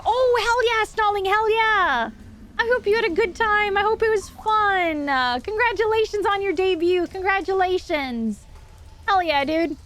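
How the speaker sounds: high, cheerful streamer voice; cute anime streamer. Real, not acted. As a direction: gush happy congratulations. Congratulating raiders on debut.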